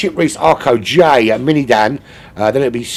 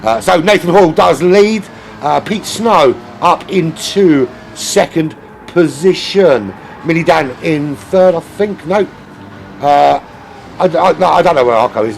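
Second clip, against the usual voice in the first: excited, higher-pitched sim racing commentary; live race play-by-play; 9 semitones higher, 7 dB louder than usual. Real, not acted. Streamer calling the lagging racer off the line, excited race commentary. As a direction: call the race start with rising urgency.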